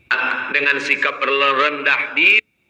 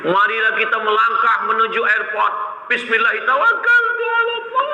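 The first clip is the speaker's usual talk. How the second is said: a lightly voiced tour leader, pitched much higher; mock-solemn send-off prayer, up high; sending the pilgrims off with a lifted, sing-song bismillah; pious tour leader; 8 semitones higher than usual.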